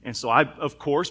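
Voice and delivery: ordinary talk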